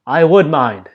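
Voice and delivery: loud, high angry snap; sharp snap. A narrator's reading. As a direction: bite the words off at her. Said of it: "he snapped".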